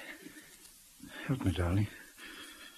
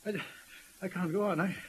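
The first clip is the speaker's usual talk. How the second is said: quiet broken voice; a man at the end of his rope; despairing; 4 semitones higher, 7 dB quieter than usual. Acted. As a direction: say it as if giving up. Breakdown, despairing.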